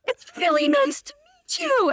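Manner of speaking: very high, manic pleasantry; hammed-up starstruck greeting; imitated yelling. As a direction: squeal it like an overexcited fan. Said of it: "just yelling at him".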